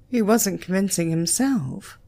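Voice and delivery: ordinary delivery, nothing marked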